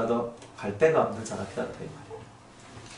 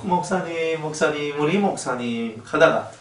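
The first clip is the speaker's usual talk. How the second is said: normal talking voice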